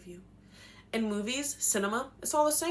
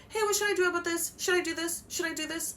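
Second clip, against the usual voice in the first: anxious asking; 9 semitones higher than usual. Retold or imitated. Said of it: "I'm like".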